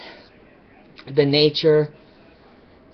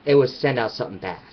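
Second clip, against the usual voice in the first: normal talking voice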